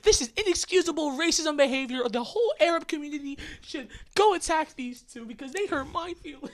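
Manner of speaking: high, loud mock outrage. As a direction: play the offended relative, loud and high. Mock outraged quote of relatives.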